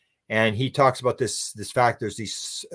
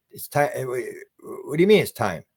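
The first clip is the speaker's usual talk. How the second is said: ordinary talk, nothing marked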